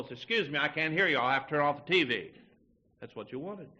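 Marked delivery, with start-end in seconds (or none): 0.1-2.3 louder, slightly raised mock host; straining host. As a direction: call out as if unable to hear. Acted host who cannot hear.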